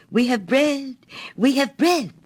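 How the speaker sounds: put-on high, bright joyful shouts of children; happy cry. A narrator's reading. Hungry children cheering the swallow's gold, joyful cry. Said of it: "as they cried happily".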